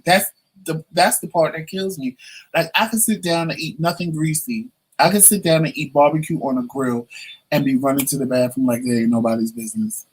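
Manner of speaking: ordinary delivery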